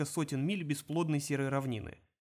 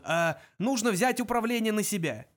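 high, urgent, raised voice; crying out; 6 semitones higher, 4 dB louder than usual. A narrator's reading. Zaphod decides to seize the controls, urgently exclaiming. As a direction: blurt out the plan urgently. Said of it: "вскричал он".

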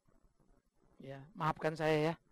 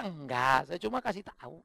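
ordinary talk

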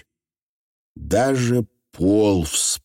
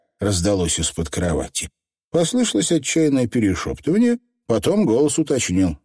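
usual speaking voice

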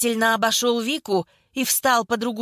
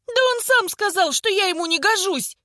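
high-pitched, exasperated outburst; vexed cry; 9 semitones higher than usual. A narrator's reading. Vika defending herself to her teasers, vexed cry. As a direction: cry out at the end of your patience. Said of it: "выкрикнула в конец раздосадованная Вика".

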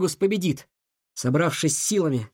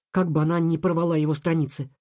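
ordinary delivery

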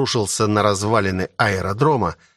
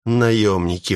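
plain reading voice

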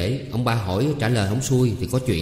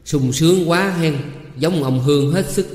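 bitter mock admiration, pitched up; seething sarcasm; 7 semitones higher, 5 dB louder than usual. A narrator's reading. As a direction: needle the husband with angry sarcasm. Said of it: "càng tím gan tím ruột".

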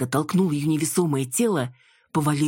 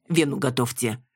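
usual speaking voice

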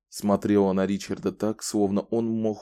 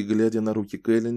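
usual speaking voice

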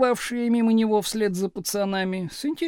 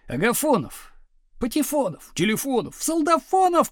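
teasing corrections; 6 semitones higher than usual. A narrator's reading. Pupils mis-correcting teacher's roll call, teasing calls. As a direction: call out each silly correction. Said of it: "поправляли Слушкина".

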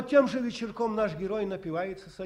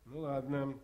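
normal talking voice

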